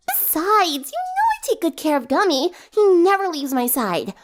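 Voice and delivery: high, quick, chirpy voice; chirpy Pinkie. A narrator's reading. Pinkie boasting about Gummy, high and chirpy. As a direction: chatter brightly about your pet.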